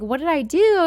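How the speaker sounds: retold baffled protest; confused protest. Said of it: "so confused".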